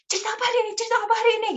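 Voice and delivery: put-on high excited kid asking for a story; eager child voice. Children crowding the storyteller, asking in high kid voices. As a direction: squeak it like an eager little kid.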